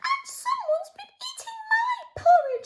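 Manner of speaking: very high, tiny, tearful squeak; squeaky Baby Bear. Acted. Storyteller voicing Baby Bear, a high tearful squeak. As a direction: squeak it out like a sad little cub. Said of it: "squeaked Baby Bear".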